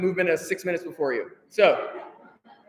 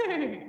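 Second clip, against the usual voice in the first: high, fluttering mock neigh; whinny the word "Neigh" like a horse; 7 semitones higher, 7 dB quieter than usual. Shown as a demonstration. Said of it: "neigh like a horse".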